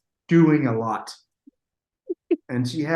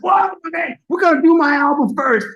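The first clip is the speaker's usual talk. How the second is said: high, loud, excited quote; boisterous mock entrance; 9 semitones higher, 5 dB louder than usual. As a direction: burst in imitating an overeager star. Acting out Bobby's loud studio entrance.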